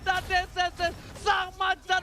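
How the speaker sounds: loud, high shouting call; live play-by-play shout. Acted. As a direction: scream the comeback to the crowd. S0 calls the decisive fight.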